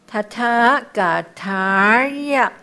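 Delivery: slow 塔塔嘎塔呀 with the held 塔 curling into an r; curled sound. Shown as a demonstration. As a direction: draw out 塔 and curl the tongue before 呀. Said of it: "有没有卷音了".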